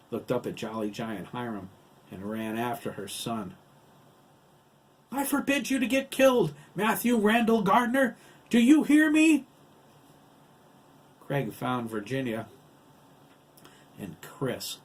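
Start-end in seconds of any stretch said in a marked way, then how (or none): 5.1-9.5 very high, loud scolding shout; panicked mother laying down the law; frantic maternal command. A narrator's reading. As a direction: yell after your son in fearful authority. Mother shouting after her running son.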